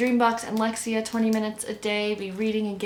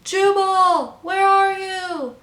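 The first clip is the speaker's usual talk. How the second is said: high, long-drawn searching call; distant calling; 12 semitones higher, 7 dB louder, much slower than usual. A narrator's reading. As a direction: call across the water, stretching the words. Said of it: "A faint voice called in the distance".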